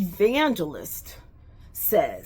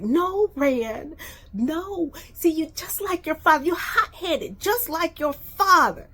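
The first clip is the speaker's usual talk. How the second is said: pleading mom; 8 semitones higher than usual. Retold or imitated. Mom voiced scolding, near tears.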